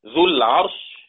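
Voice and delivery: usual speaking voice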